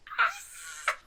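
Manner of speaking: brief, very high excited squeal. Real, not acted. Reader almost done with the book, squealing high. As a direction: squeal at nearly finishing.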